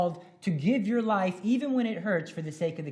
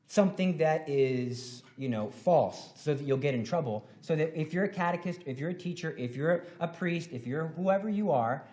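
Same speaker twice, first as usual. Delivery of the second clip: ordinary talk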